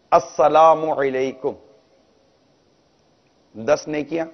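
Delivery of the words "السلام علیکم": السلام علیکم said slowly, each syllable full; slow proper salaam. Shown as a demonstration. Preacher demonstrates the correct salaam, slow and full. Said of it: "سلام کرنا صحیح ہے".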